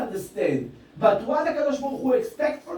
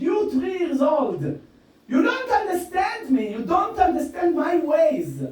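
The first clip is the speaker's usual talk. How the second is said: fervent preaching; 4 semitones higher than usual. Acted. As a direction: hammer the message home like a father pleading. Preaching at full force.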